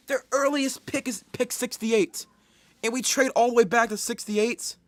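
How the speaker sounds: loud, high-pitched indignation; incredulous fan outburst; appalled disbelief. Real, not acted. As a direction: protest it as if unbelievable. Appalled at the trade.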